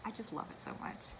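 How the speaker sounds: a soft, breathy whisper of affection; whispered aside. Real, not acted. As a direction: breathe it out as a fond secret. Tender aside about her pattern.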